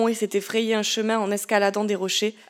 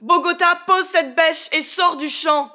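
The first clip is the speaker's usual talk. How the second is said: high loud shout, slowing on the orders; shouting commands; 7 semitones higher, 8 dB louder than usual. Acted. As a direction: order them away, loud and slow. Nunez crie ses ordres aux aveugles. (ordres criés, forts et lents)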